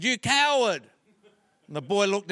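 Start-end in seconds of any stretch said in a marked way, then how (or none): 0.0-0.8 slightly raised echo call; light mock holler; echoing answer. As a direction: mimic the shout coming back. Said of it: "The voice answered".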